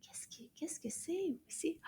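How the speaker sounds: high whispered puppet question; hushed, tiny voice asking. Pretend. Puppet Anana whispers a question to the teacher.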